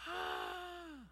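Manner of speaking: a high, airy gasp of wonder; hammed-up sigh of awe; mock awestruck ah. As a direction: swoon like a dreamy onlooker. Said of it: "all of us in the twenty-first century go".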